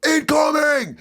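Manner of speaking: high battle-cry yell; re-enacted war shout; shouted warning. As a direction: holler it like a combat alarm.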